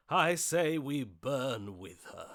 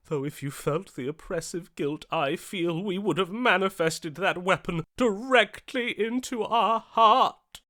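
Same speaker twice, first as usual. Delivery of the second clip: rising bitter outburst; 6 semitones higher, 8 dB louder than usual. Acted. Bitter outburst about guilt.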